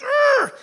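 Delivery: high, loud frustrated roar; road rage growl. Retold or imitated. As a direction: let out an angry growl. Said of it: "I'm like".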